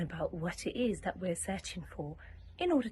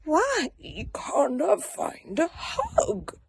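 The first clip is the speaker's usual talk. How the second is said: plaintive; 9 semitones higher, slower than usual. Acted. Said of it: "he said".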